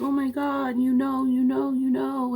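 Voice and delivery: retelling a tearful boy, lightly acted; imitating crying. A hint of the son's friend in tears. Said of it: "he burst into tears".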